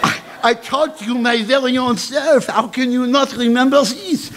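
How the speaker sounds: put-on high French-accented headmistress protesting; insistent headmistress. Headmistress claims she taught the student, high and insistent.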